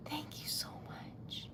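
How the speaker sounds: slow, real whispered thanks; hushed, drawn-out thanks; overwhelmed gratitude. Real, not acted. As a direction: whisper it slowly, deeply touched.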